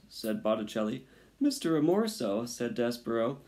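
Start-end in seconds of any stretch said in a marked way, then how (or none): 1.4-2.5 high, raised tearful plea, lightly acted; a touch of crying, high and loud; crying mouse. A narrator's reading. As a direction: plead through acted tears. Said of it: "and he was crying now".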